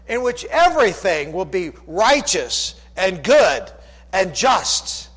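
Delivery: loud, high, fervent sermon swell; full-force preaching. Acted. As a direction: lift the congregation toward the kingdom. Preaching swell about the kingdom.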